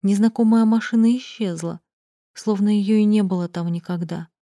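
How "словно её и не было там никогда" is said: usual speaking voice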